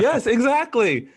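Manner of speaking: high, slow, drawn-out yes exactly; excited agreement. Real, not acted. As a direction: stretch it out, thrilled to remember. Excited recognition of actor.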